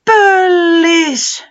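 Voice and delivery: very high, very loud glad shout; long falling cry of delight; joyful cry. Acted. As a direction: call out the friend's name in relief. Lost creature recognizes friend.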